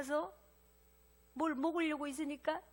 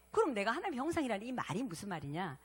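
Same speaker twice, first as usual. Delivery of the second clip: ordinary talk, nothing marked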